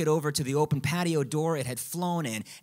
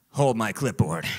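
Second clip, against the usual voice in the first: mock-heroic bravado; 6 semitones lower than usual. Mock hero before the jump.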